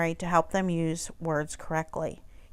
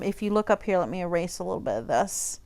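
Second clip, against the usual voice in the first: ordinary delivery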